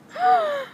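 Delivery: sharp high gasp of delight. Real, not acted. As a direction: gasp at the prize. Shows yarn she won.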